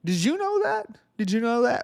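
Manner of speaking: sarcastic, high-pitched disbelief. Pretend. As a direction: feign shock at this revelation. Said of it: "I'm being sarcastic".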